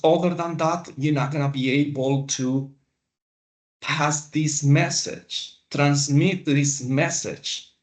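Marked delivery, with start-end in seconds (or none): none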